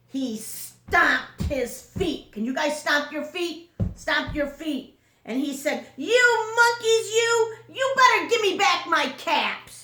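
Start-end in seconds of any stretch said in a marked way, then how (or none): 6.0-9.7 furious peddler. Acted. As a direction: yell at the monkeys in fury. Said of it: "Now he was furious".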